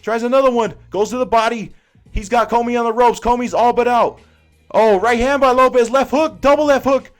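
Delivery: fast, high, loud ringside call; frantic boxing commentator calling a knockout; live fight play-by-play. Acted. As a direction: call the knockout punch by punch at full pitch.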